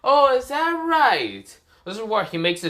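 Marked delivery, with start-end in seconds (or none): 0.0-1.5 high, slow, loud sneer; Frio's taunting villain. Acted. Frio answering Boruto's vow, high taunting villain voice. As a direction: stretch it out high as a mocking challenge. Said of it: "Frio is like".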